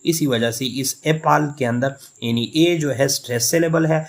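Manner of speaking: ordinary talk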